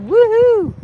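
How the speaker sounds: high, loud happy whoops; excited cheering. Real, not acted. Streamer cheering as a viewer joins the live. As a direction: cheer like a fan.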